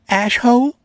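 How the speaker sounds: put-on high, loud small girl's voice over the intercom; small voice calling. A narrator's reading. Namie teasing the narrator over the intercom, high and loud. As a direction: call down teasingly in a tiny high voice. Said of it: "a small voice called from overhead".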